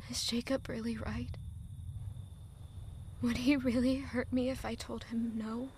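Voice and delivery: hushed, frightened whisper to herself; whispered doubt. Acted. Heroine whispering her fear to herself, hushed. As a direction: breathe the doubt out. Doubting inner thought, unvoiced.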